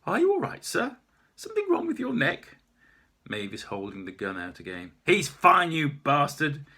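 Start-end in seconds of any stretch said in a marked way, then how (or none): 0.1-2.6 much higher, louder puzzled query; confused policeman voice; baffled officer. Acted. 5.0-6.6 loud, higher vicious snap; armed woman snarling; snarling threat. Acted. Maeve snarling, aiming gun.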